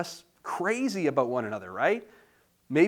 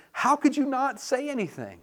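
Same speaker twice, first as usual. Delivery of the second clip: much higher exasperated question; incredulous; 9 semitones higher than usual. Retold or imitated. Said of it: "and I'm like".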